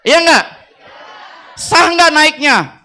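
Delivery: loud, high call to the crowd; rallying shout. Real, not acted. Speaker to the teachers, loud rallying call. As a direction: rouse the room for an answer. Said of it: "calling audience for response".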